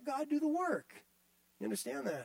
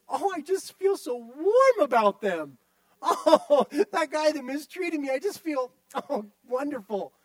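mock gushing; 4 semitones higher, 4 dB louder than usual. Mocking gushing feelings.

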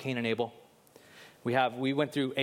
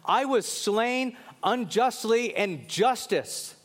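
slow, high-pitched plea for justice; crying out; 7 semitones higher, much slower than usual. Acted. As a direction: cry it out slowly, drawing out each word. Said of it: "Abel's blood was crying out was this".

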